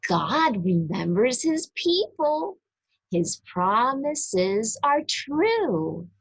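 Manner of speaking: ordinary delivery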